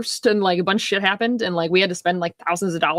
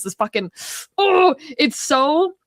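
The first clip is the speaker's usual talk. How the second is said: usual speaking voice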